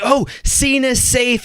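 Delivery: high, slow, overdone rescuer voice; mock dramatic hero. Nurse playing up a medical emergency, hammed-up hero voice. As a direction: ham up the take-charge moment.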